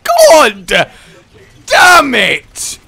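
loud shout in separate bursts; frustrated swearing. Real, not acted. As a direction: yell the curse in frustration. Swears after setback.

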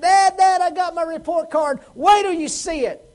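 put-on high, loud, eager child calling his dad; excited boy. Boy running in to show his dad the report card, excited high call. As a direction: burst in like a thrilled kid waving his report card.

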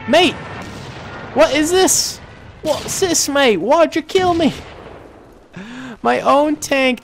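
high-pitched baffled complaint; indignant disbelief. Real, not acted. Gamer to the teammate who killed him, indignant. Protesting the friendly-fire death.

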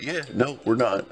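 ordinary delivery

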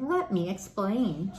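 plain reading voice

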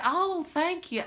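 high, drawn-out grateful thanks; demonstrated pleasant reply. Shown as a demonstration. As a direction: gush a sweet thank-you. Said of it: "something pleasant".

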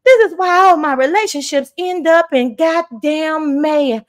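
hammed-up pleading Southern accent. Mocking a smitten fan confessing love to a streamer, gushing Southern voice. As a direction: gush like a smitten Southern damsel. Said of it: "get out my real Southern voice".

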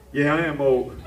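low laughing self-jab; laughing aside. Real, not acted. Host joking about being old, low laugh. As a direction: laugh at yourself quietly.

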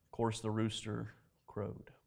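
normal talking voice

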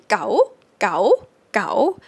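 scoop gao upward; rising tone gao. Shown as a demonstration. Teacher modelling rising-tone gao for students. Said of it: "the rising tone version".

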